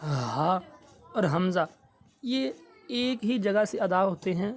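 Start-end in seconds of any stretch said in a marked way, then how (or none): 0.0-0.6 ہا breathed from deep in the throat; low guttural ہا demo; low throaty ہا from the lower throat; throat sound. Shown as a demonstration. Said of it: "یہ جو ہے نا ہا".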